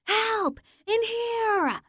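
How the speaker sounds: high, raw scream toward the door; scream as loud as possible. Acted. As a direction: shout to be found. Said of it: "I scream as loud as I can".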